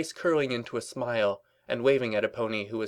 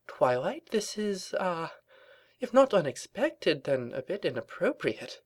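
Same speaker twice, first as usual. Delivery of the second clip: high, hesitant, awkward let-down; nervous awkwardness; 8 semitones higher than usual. A narrator's reading. Rarity misreads Twilight's confession, nervous and hesitant. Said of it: "Rarity coughed nervously".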